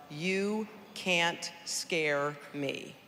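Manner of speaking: slow, each word set apart; slow defiant words. Real, not acted. Survivor tells the audience her motto, slow and defiant. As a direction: lay down the four words slowly, defiant.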